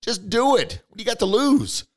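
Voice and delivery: high, loud push to act; pumped-up urging. Real, not acted. Coach urging a doubter, high and loud. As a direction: fire them up. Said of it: "urging listeners to act".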